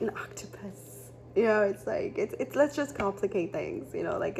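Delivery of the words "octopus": a soft breathy whisper on the single word; hushed reveal. Real, not acted. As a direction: whisper it like a secret card reveal. Revealing the octopus card.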